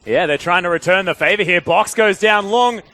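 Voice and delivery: high, loud esports shoutcast; excited play-by-play. Real, not acted. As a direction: belt out the fight as it breaks. Live play-by-play at full pitch.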